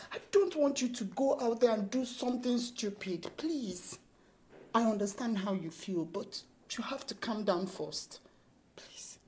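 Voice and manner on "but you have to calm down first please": soft, slow pleading; hushed plea. Acted. Laura asking Josh to calm down, hushed plea. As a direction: plead softly, fading to a whisper.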